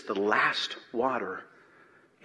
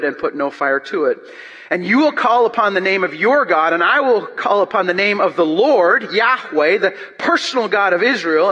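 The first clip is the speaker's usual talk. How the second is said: loud, high, driving pulpit reading; preaching at full force; 6 semitones higher, 6 dB louder than usual. Acted. Preacher to the congregation, reading Elijah's challenge, booming. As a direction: proclaim it to the back row.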